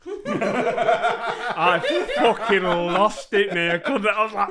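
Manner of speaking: high, loud, laughing hard, slow; can barely get it out for laughing; real laughter breaking through. Real, not acted. Laughing while retelling.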